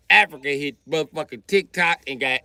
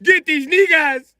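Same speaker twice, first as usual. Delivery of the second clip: much higher, whiny plea to a rescuer; high pleading complaint; 10 semitones higher than usual. Retold or imitated. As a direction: beg for help up high, wounded. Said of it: "They was like".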